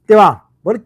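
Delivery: normal talking voice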